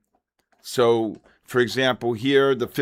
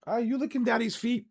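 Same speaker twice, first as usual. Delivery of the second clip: mocking voice; 13 semitones higher, 5 dB quieter than usual. Mocking a football player.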